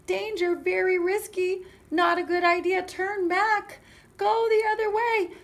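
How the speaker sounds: high, loud calls of danger; urgent warning. Acted. Forest warning Goldilocks off the shortcut, urgent calls. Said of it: "It's trying to warn her".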